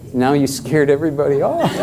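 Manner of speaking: teasing, through a laugh; laughing playful tease. Real, not acted. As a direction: laugh while you tease the crowd. Teasing after odd answer.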